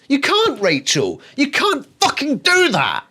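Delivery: high, loud disbelieving rant at a character; exasperated outburst. Real, not acted. Reviewer yelling at Rachel in the book, exasperated. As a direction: scold her like she's in the room.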